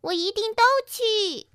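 eager, high, rising voice; decisive. A narrator's reading. Pinky answers her friends' invitations, decisive and high-pitched. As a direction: answer firmly and brightly without a second thought. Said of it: "碧琪干脆地回答道".

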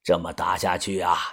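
put-on low, soft elderly voice; low hushed elder voice; old boss. A narrator's reading. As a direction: give quiet orders to the young man. Old boss gives orders.

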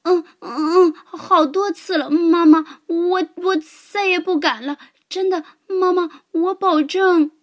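high, loud whimpering promise; giant whimpering. A narrator's reading. Giant whimpering apologies to his mother, high-pitched. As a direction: snivel like a scolded child. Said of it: "巨人呜咽着说".

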